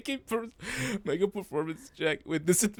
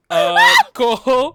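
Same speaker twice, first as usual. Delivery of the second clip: character voice; 10 dB louder, slower than usual. Acted. GM voicing Devin greeting Cole.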